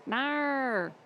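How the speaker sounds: put-on playful Australian accent. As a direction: echo the Aussie no. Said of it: "That's how they".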